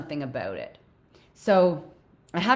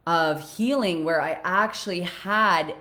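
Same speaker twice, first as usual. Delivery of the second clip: ordinary talk, nothing marked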